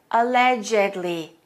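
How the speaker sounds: allegedly with ED as its own syllable, al-LEDGE-ed-ly, slow; careful allegedly. Shown as a demonstration. Teacher modelling allegedly for students to repeat. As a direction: say allegedly slowly, four syllables. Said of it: "pronounced this way".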